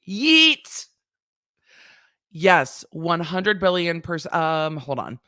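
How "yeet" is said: high, loud celebration shout; excited yeet. Real, not acted. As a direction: shout it in triumph. Cheering [name]'s line.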